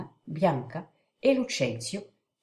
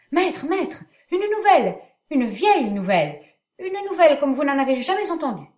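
urgent panting report; 8 semitones higher, 4 dB louder than usual. Acted. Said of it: "Biondello arrive en courant".

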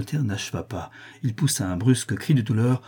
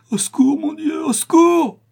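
very high, loud agonised scream; screaming for help; 6 dB louder than usual. A narrator's reading. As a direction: scream for help in terrible pain. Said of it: "s'écria-t-il".

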